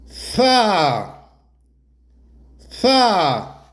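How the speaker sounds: fa avec le a longuement tenu; fa, souffle net puis a ouvert prolongé. Shown as a demonstration. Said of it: "on prolonge la prononciation". (fa étiré lentement pour l'élève)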